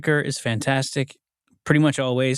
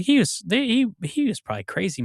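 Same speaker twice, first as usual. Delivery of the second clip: high, scoffing mock of the bar crowd; lightly voiced bar regular brushing him off; mocking coworkers; 9 semitones higher than usual. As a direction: sneer it like a dismissive drunk at the bar. Coworkers mocking dead George.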